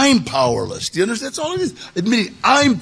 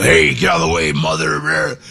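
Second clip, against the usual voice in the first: convict growl; 11 semitones lower than usual. Retold or imitated. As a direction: growl it like a tough convict on set. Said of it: "Just really growling".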